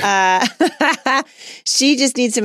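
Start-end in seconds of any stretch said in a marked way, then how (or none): 0.5-1.2 a bright, higher burst of laughter; real laugh. Real, not acted. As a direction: laugh at the joke aimed at Steve. Laughs at teasing co-host.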